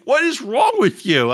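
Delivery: high, drawn-out mock exasperation; mock yell. Fed-up son to screaming parent, high mock yell. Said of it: "He yells down at me".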